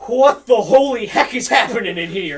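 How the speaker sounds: big blustering lawman; commanding outburst. Acted. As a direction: boom it out in comic outrage. Guard captain bursts in on chaos.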